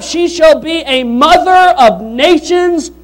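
slow, loud preached climax; building climax. Acted. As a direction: land the promise like a crescendo. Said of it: "This is building up".

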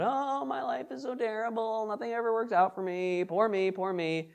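soft, high mock whine; whiny complaining. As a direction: whimper in mock self-pity. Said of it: "complaining as in a whiny sense".

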